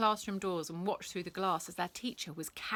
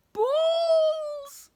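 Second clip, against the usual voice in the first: very high, loud long scream; 11 dB louder than usual. Acted. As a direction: scream it as the wave sweeps him off. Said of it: "screamed Pent".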